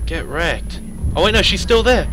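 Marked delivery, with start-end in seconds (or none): 1.2-2.1 alarmed. Real, not acted. Ghost reappears.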